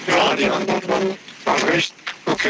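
glitchy robotic phone line; broken, robotic call audio. Real, not acted. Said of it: "Du klingst wie ein Roboter gerade".

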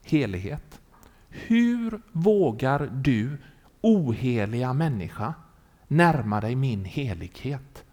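slow, low, solemn voice of God; God's voice. Retold or imitated. God confronts a man at the holy tree, slow and solemn. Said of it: "då hör han Guds röst".

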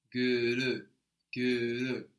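"Görü" with rounded lips, ü like U shaped into E; "görü" said slowly, lips rounded for ü; Turkish ü sound. Shown as a demonstration. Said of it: "put our lips in the position of U and then try to make an E".

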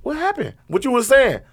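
high-pitched prodding questions; retold parental questioning. Dad retelling how he questioned Vinny, high prodding. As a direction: coax a confession, voice lifted high.

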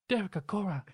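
high, far voice, scolding and hurt; Sam impression. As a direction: nag Derek like a fed-up spirit friend. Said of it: "He's like".

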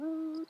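high-pitched held "ooh", sung out lightly; high soft "ooh". Shown as a demonstration. Engineer showing how artists jump in volume, high soft coo. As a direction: demo of a singer's smooth high note. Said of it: "can't just go from".

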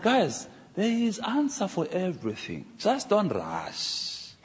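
slow, drawn-out reassurance with a laugh; slow calm reply. Retold or imitated. To students asking about God, slow and calm. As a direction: take your time, easing the students.

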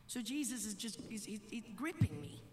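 low, soft, halting question; subdued reply. Retold or imitated. Father taking in son's answer, hushed and halting. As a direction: feel your way through it quietly.

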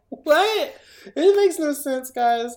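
high, loud, baffled protest; exasperated disbelief. Real, not acted. Reviewer tells friends about the ending, exasperated disbelief. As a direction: cry out at the nonsense of it.

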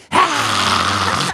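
high, loud animal shriek; animal screech. Retold or imitated. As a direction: imitate the cat screeching at you. The genet's screech.

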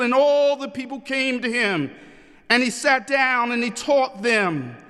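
scripture proclamation. Acted. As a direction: proclaim the verses to a congregation, phrase by phrase. Reads scripture aloud to congregation.

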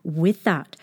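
plain reading voice

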